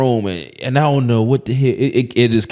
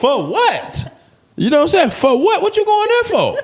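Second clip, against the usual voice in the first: much higher, laughing mock question; laughing disbelief. Real, not acted. Mocking someone who says they're going to Milwaukee, laughing. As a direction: laugh at the very idea.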